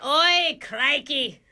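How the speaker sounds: put-on broad Aussie accent; hammy Aussie voice; Australian accent. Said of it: "did you just change your accent".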